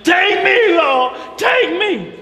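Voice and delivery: high, loud wail to the Lord; hollering. Retold or imitated. Sarah hollering to the Lord in grief, high and loud. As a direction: cry out take me, stretching it slowly. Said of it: "why is she hollering".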